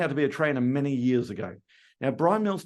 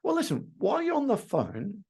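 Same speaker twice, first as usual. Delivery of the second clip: upbeat salesman; 8 semitones higher than usual. Shown as a demonstration. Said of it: "turn around and say".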